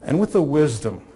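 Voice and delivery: ordinary talk, nothing marked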